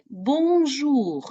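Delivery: high, sing-song «бонжур»; teacher modelling a French greeting; model greeting. Shown as a demonstration. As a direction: greet them brightly with «бонжур». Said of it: "Репете".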